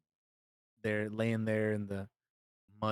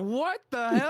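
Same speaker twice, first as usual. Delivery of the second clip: high, loud, baffled outburst; shocked disbelief; 11 semitones higher, 6 dB louder than usual. Retold or imitated. Reader finding the story goes on, incredulous. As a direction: re-enact being floored, pitch jumping way up.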